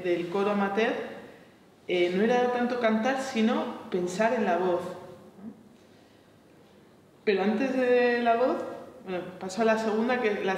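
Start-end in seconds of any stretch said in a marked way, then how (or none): none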